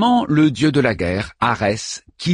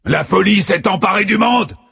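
war god's furious high roar; battle roar; 10 semitones higher, 3 dB louder than usual. A narrator's reading. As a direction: roar it at the gods. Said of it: "rugit le dieu de la guerre". (rugissement furieux du dieu de la guerre)

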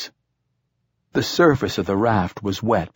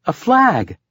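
put-on high, loud girl's exclamation; sudden high-pitched, louder outburst; excited cry; 12 semitones higher, 6 dB louder than usual. A narrator's reading. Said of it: "cried Aeneas".

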